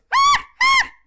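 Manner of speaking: very high, loud screeching shriek; high monkey screech. Shown as a demonstration. Showing viewers the high pitched monkey screech. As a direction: screech as high as you can. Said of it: "so it's".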